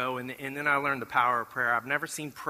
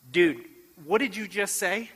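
pitched up in surprise; dude, did you really just say that; startled question; 6 semitones higher than usual. Retold or imitated. Brian stopping him in the courtyard, surprised and higher.

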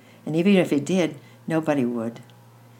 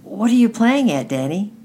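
put-on louder, higher question to a child; calling out aloud; 7 semitones higher, 8 dB louder than usual. A narrator's reading. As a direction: call it out, starting high. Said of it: "And then aloud he said".

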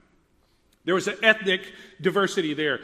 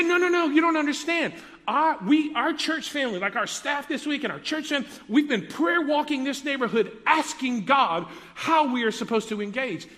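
high, rushed, eager pastor voice, raised well up; 7 semitones higher, 3 dB louder than usual. Retold or imitated. Pastor explaining his church's prayer walks, eagerly. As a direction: gush it out like the excited pastor.

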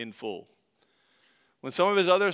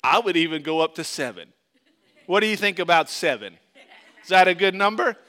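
louder, higher, self-satisfied offer; pleased with himself; 4 semitones higher, 8 dB louder than usual. Acted. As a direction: proudly pitch a generous offer. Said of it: "Peter thinks he's being really good".

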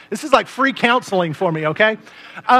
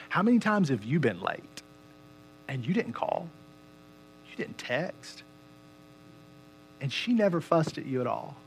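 quiet voice of God; 3 semitones lower, 8 dB quieter than usual. Retold or imitated. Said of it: "And he said".

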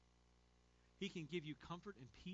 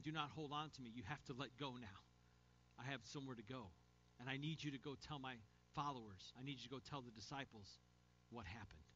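softer, lower, tender reassurance; gentle compassion; 5 semitones lower, 5 dB quieter than usual. Acted. Jesus gently sending Mary to the disciples, soft and compassionate. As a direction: let her go kindly, with no rebuke. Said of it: "speaks to her in her pain with a gentle voice".

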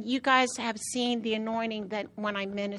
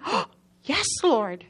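mildly excited louder cry; a touch of eager joy, louder; 3 semitones higher, 6 dB louder than usual. Retold or imitated. Preacher recalls answering the Lord, mildly excited. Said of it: "like, excited".

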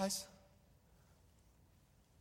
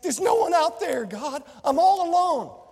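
high, loud anguished plea to God; despairing cry; 7 semitones higher, 7 dB louder than usual. Retold or imitated. Elijah in the cave crying out to God, loud anguish. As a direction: cry out alone and abandoned.

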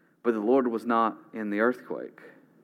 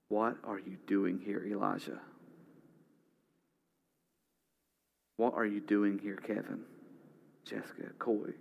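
softer, hushed divine question; soft voice of God; 8 dB quieter than usual. Acted. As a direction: ask gently, almost hushed. God's gentle voice to Elijah.

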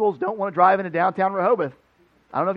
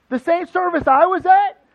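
high, loud, slow disbelief; can't believe what you're hearing; stunned rising question; incredulous question; 11 semitones higher, 9 dB louder, slower than usual. Retold or imitated. Disbelief at Terrell's claim.

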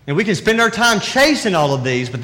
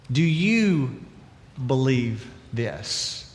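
soft, slow question; 5 semitones lower, 6 dB quieter, much slower than usual. Shown as a demonstration. Preacher asks the congregation, soft and slow. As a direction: do you believe this said very softly and slowly, believe drawn out. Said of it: "So let me ask you".

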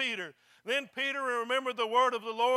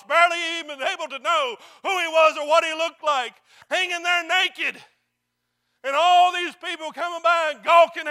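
loud, higher, impassioned pulpit delivery; full-force preaching; 4 semitones higher, 10 dB louder than usual. Real, not acted. Preacher telling of the Lord's humiliation on the cross, fervent and loud. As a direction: build to a forceful shout at the congregation.

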